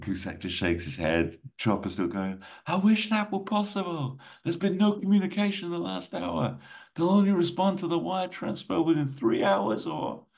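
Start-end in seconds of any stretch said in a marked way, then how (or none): 2.7-10.3 high, raised, urgent plea; urgent official. Acted. Official briefing Poe under chopper blades, high urgent voice. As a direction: push it up high, as if over helicopter blades. Voices Cusack over helicopter noise.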